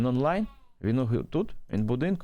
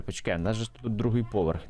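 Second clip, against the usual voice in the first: ordinary talk, nothing marked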